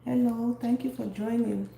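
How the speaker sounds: ordinary talk, nothing marked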